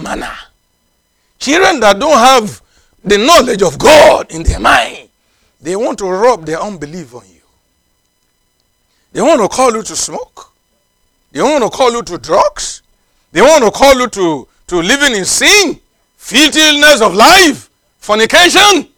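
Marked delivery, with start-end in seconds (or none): 1.4-5.1 loud, high shouted warning; preaching shout. Acted. Preacher shouts warning about children. 13.3-18.9 building sermon. Acted. Building list of sins.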